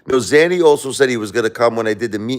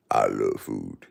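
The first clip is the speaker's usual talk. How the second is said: I love food said in a much lower put-on voice; 11 semitones lower, 8 dB quieter, slower than usual. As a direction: drop the voice low on I love food. Repeats line in new voice.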